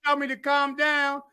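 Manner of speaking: ordinary talk, nothing marked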